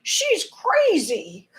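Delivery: whispered mock of a doubter; mocking critic. Viewer scoffing at her, mock whisper. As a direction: hiss it like a sneering skeptic. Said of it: "sound like they're conspiracy theories".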